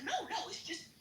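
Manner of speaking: high-pitched hedging imitation; mock backpedaling. Sergeant denying her face looks weird, high mock backpedaling. Sergeant backs off in retold answer.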